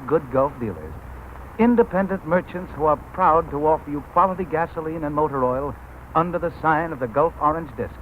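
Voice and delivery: normal talking voice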